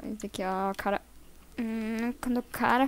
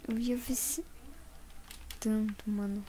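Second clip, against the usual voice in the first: whispering; 4 dB quieter than usual. Real, not acted. Repeats the same words whispered.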